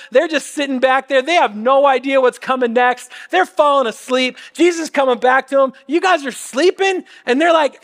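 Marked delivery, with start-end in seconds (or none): 5.9-7.0 higher-pitched, incredulous question; mock disbelief. Jesus returning to sleeping disciples, mock disbelief. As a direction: act out catching them napping, pitch lifted.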